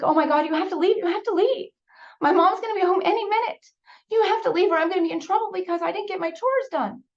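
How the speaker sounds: high, loud, frantic; urgent panic, get him out now; panicked child. Retold or imitated. Frightened girl telling the man to leave, panicked.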